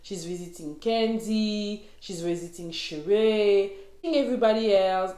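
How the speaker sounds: ordinary delivery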